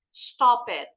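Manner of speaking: "Stop it" with a rounded British O. Shown as a demonstration. Teacher shows the class a British "Stop it". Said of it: "the British would mostly pronounce this word as stop".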